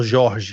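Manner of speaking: "Jorge" in Brazilian Portuguese, both J's as zh. Shown as a demonstration. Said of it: "We spell it with the J".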